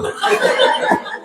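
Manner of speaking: soft breathy laugh; a short sheepish chuckle; embarrassed laugh. Real, not acted. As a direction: laugh quietly at yourself. Laughs at own embarrassing story.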